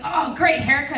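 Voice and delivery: joking. Retold or imitated. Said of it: "a stupid joke".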